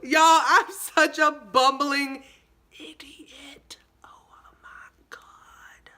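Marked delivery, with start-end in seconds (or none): 2.8-5.9 whisper. Real, not acted. Embarrassed aside to viewers.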